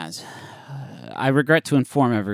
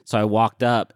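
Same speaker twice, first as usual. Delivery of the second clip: ordinary talk, nothing marked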